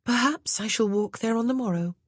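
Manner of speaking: put-on a young lady at a loss; Grace, the new lady of the house. A narrator's reading.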